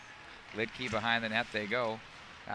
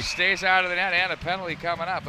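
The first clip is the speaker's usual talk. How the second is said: louder and higher, rapid-fire scramble call; excited play-by-play; 5 semitones higher, 9 dB louder than usual. Real, not acted. Announcer calling a goalmouth scramble, excited and loud. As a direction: ride the chaos in front of the net.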